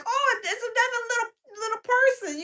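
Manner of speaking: getting excited. Retold or imitated. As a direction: gush like you spotted a friend. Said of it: "I be getting all excited".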